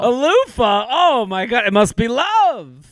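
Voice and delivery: high, gushing mock awe; mock swooning. Co-host teasing the letter writer's loofah gift, mock-swooning. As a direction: gush over it like it's a grand romantic gesture. Mocking listener's gift list.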